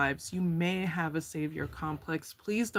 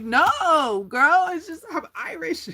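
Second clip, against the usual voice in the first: high, loud exasperated retort; exasperated friend; 10 semitones higher, 8 dB louder than usual. Retold or imitated. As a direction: play the friend losing patience with a high loud no, girl. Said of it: "they're like".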